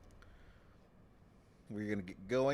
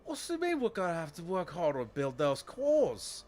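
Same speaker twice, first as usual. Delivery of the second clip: much higher, louder complaining worker voice; whiny worker; 11 dB louder than usual. Acted. Striking worker whining to the leader, high and loud. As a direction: squeal like an entitled striker demanding free stuff.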